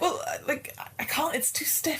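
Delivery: high strained, fading to a whisper; high strained whimper. Acted. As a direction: strain against a stiff handle. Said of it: "she's like".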